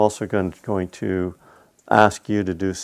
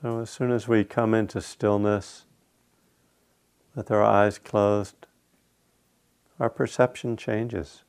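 guided meditation. Acted. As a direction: lead them unhurriedly into stillness, phrase by phrase.

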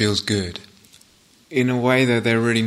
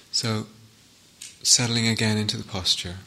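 calm meditation guide with long pauses between phrases; guided meditation; 4 dB quieter than usual. Real, not acted. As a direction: settle listeners into stillness, phrase by unhurried phrase.

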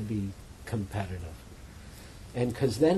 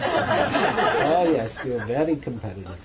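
put-on playful Indian guru accent, teasing; 6 dB louder, slower than usual. Teacher [name] teasing a competitive student, Indian guru accent. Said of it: "in this Indian guru accent".

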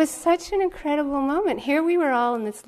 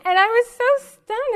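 laughing; 13 semitones higher, 6 dB louder than usual. Real, not acted. Laughing at her own story.

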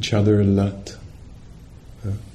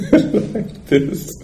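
breaking into a laugh mid-phrase; words bubbling out through a chuckle; 12 semitones higher than usual. Real, not acted. Teacher admitting the natural state rarely feels so, laughing.

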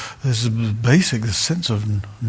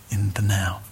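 a hushed, voiceless whisper; mock whisper; 4 semitones lower, 4 dB quieter than usual. Retreat teacher teasing "just be in the now" in a mock whisper. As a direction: breathe it out like a sacred secret.

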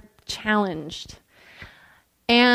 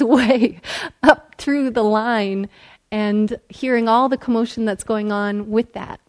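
ordinary talk

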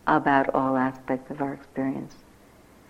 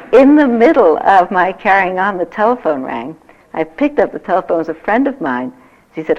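ordinary delivery

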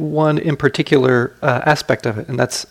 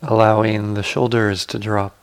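guided meditation. Acted. Teacher guiding sitters to relax the body, calm guided meditation.

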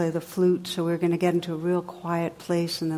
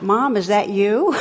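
loud, puzzled call into the phone; imitated caller's voice; 2 semitones higher, 8 dB louder than usual. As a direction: mimic a child calling out for mom. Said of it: "So you hear this voice going".

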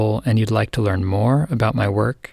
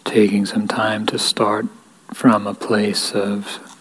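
guided meditation. Real, not acted. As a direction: lead them gently into stillness, unhurried, leaving space between phrases. Leads a guided equanimity meditation.

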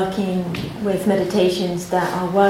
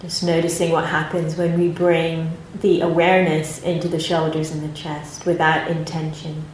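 calm, unhurried meditation guidance with long pauses; guided meditation; 4 dB quieter than usual. Acted. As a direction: gently guide the group into their posture and body.

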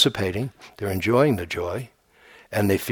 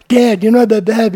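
put-on much higher and louder eager little boy; child's voice; 11 semitones higher, 9 dB louder than usual. Teacher imitating himself as a boy telling his father, high and loud. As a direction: pipe up like a small son to his dad. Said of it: "I would come home".

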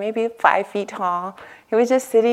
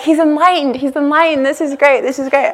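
mock-enthusiastic, higher and louder; gushing others; 7 semitones higher, 5 dB louder than usual. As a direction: parody the starry-eyed crowd. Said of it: "everyone was like".

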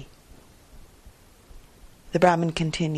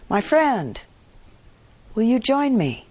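calling out; 6 semitones higher, 3 dB louder than usual. Acted. Farmer calls out to merchant.

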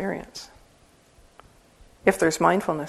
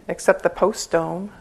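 ordinary talk, nothing marked

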